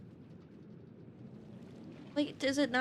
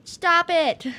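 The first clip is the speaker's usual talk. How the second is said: loud, high command to stop; exasperated yell; 6 semitones higher, 10 dB louder than usual. Real, not acted. Attacked in game.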